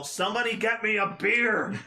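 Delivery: loud call across the room; yell. Acted. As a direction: shout for a beer. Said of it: "I'm gonna yell".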